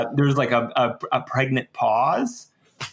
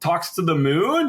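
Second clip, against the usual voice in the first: usual speaking voice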